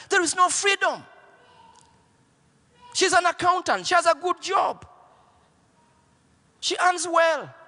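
high, fervent preaching voice. Acted. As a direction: preach it to the crowd with rising fire. Preaching at rising force.

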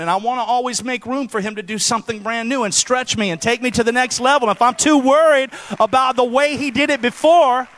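normal talking voice

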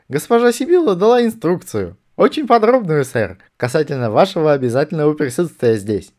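high, loud soldier's report; corporal reporting stiffly. A narrator's reading. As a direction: stare straight ahead and report. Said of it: "глядя прямо перед собой, откликнулся". (доклад капрала, высоко и громко)

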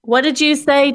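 normal talking voice

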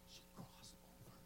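near-silent whisper; breath-only, very soft delivery; hushed awe. Real, not acted. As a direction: whisper it for dramatic weight. Hushed climax of her testimony.

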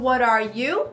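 "What are you" with all three words sounded; full form. Shown as a demonstration. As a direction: model "What are you" clearly, ARE kept. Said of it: "Mais rápido". (forma completa, bem articulada)

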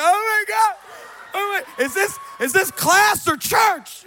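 high, loud hysterical outcry; mock tearful shock. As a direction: imitate an overwhelmed crowd wailing in amazement. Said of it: "and everybody's crying. They're like".

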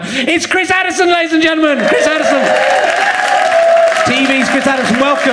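high-pitched, rousing announcer call; guest introduction. Real, not acted. Host bringing Chris Addison on stage, rousing introduction. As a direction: whip up applause for the guest.

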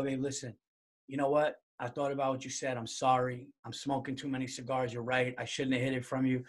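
husband sheepishly owning up to his wife; meek retold apology. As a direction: apologize as if treading carefully.

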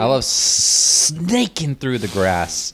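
playfully slithering, slowed delivery. Pretend. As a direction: stretch the S like a snake. Playing on sniffing with drawn-out hiss.

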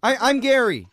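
hard-of-hearing guy. Acted. Deaf Gary character.

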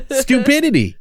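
high, mocking punchline; sarcastic punchline. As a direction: land the jab with mock glee. Punchline about state education.

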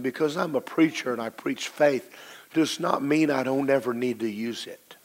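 ordinary delivery

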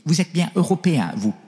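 lightly voiced old Chinese bishop, Chinese accent; slight Chinese accent. Old Chinese bishop greeting the priest, light Chinese accent. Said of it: "mais petit accent chinois".

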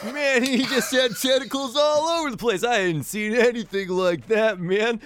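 high, loose dude voice, arms wide; surfer dude hug. Acted. Floon calling the pretty lady in for a hug, high dude voice. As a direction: pull her into a goofy grateful hug. Floon describes tentacle man.

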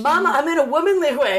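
high, put-on girlish announcement; excited daughter. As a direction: call out to Mama like a thrilled girl. Voices daughter calling to mother.

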